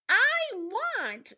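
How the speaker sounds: very high, very loud cry; high, drawn-out storybook bawl; acted sobbing wail. A narrator's reading. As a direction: wail it out with full force. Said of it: "sobbed Meg".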